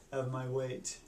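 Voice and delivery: ordinary talk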